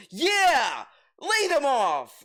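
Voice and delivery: loud, high mock cheer; hammed-up gleeful shout. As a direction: whoop it out, drawn out and over the top. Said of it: "he's like".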